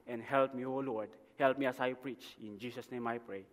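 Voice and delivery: low, soft, hushed praying voice; prayer. Real, not acted. Preacher praying to God before the sermon, softly prayerful. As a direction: pray quietly and earnestly, well under preaching level.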